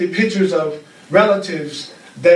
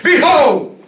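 proclaiming; 11 semitones higher, 6 dB louder than usual. Acted. Proclaiming the Course's call.

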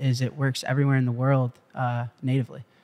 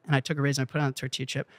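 rattled off fast and much softer, "and I took a raisin and I put it on the tortilla chip"; quick soft repeat; 8 dB quieter, much faster than usual. Shown as a demonstration. Repeating the raisin step to the bankers, fast and soft. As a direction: rush it under the breath as an echo. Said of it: "repeats the line".

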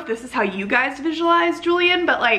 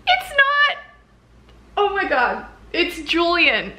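high, sharp it's not; squeaky shocked denial; shocked realization; 6 semitones higher, slower than usual. Real, not acted. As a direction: burst out in disbelief. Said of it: "sudden realization".

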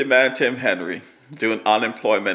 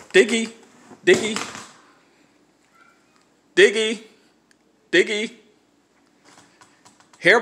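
high, loud call to a dog; calling from far away; 13 semitones higher, 7 dB louder than usual. Real, not acted. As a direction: call out across the yard.

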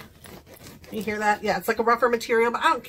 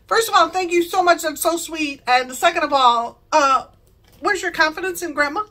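higher, louder playful retort; mock offense; 5 semitones higher, 5 dB louder than usual. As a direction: pretend to be affronted by a doubter. Acted mock-offended reply to grandson.

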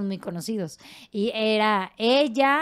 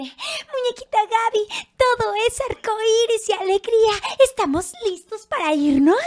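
muy aguda, lenta y cantarina; voz de muñequita; 13 semitones higher, 5 dB louder, slower than usual. Shown as a demonstration. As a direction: saludo alegre de animadora infantil. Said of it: "Así hablábamos". (voz aguda y cantarina de muñequita infantil)

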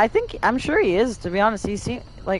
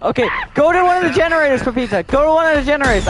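urgent shouting; 8 semitones higher, 5 dB louder, faster than usual. Real, not acted. Shouting orders while being chased.

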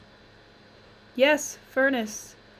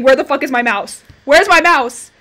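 very loud frantic demand; panicked screaming; 2 semitones higher, 12 dB louder than usual. Real, not acted. Gamer whose mouse has stopped working, panicked yelling. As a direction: shout it, freaking out. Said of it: "I'm gonna start screaming".

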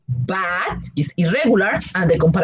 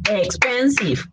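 "Expensive" in three separate beats, EX-PEN-SIVE; syllable by syllable. Shown as a demonstration. Teacher shows three or more syllables, syllable by syllable. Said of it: "Three or more syllables".

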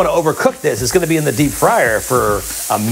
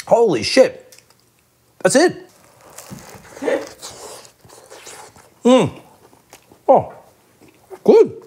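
delighted; 8 semitones higher, slower than usual. Real, not acted. First bite of the burger.

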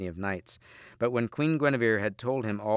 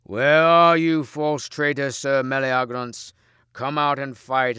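loud, drawn-out battle challenge; slow, raised roar at a foe; ringing shout; 4 semitones higher, 8 dB louder, slower than usual. A narrator's reading. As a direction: bellow so the whole castle rings. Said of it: "cried out in such a voice".

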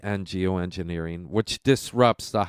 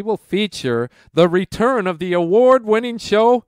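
announcer voice; 10 semitones higher, 7 dB louder than usual. Acted. Announcing a returning show segment.

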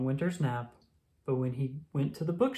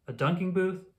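plain reading voice

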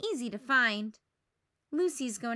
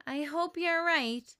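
sighing; 5 semitones higher, 4 dB louder, slower than usual. Acted.

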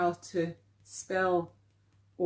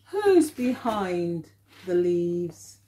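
slow, drawn-out reading of the line; slow read-aloud; 3 semitones lower, slower than usual. Acted. Teacher reading the big book to children, slow and careful. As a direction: read it slowly so children can follow.

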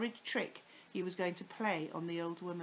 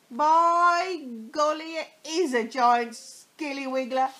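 mock-amazed husband; 7 semitones higher, 14 dB louder than usual. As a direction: ham up the surprise.

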